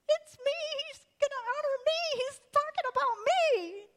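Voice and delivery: high, loud gushing squeal; giddy self-important glee. Acted. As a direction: squeal with delight, certain the honor is yours. Haman gushing over imagined honor.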